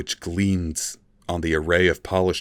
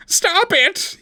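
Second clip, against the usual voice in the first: much higher, louder desperate plea; pleading; 5 dB louder than usual. A narrator's reading. The tortured elf begs his tormentor, high desperate plea. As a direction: cry out in distress. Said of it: "he pleaded".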